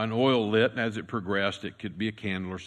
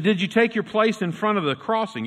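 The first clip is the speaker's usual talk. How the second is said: sharply raised, loud interrogating voice; pressing question; 12 semitones higher, 9 dB louder than usual. Retold or imitated. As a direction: grill him loudly and high. Chairman grilling Ben at inquiry.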